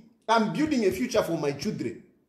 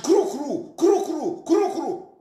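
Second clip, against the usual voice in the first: imitated car noise; 4 semitones higher, 4 dB louder, slower than usual.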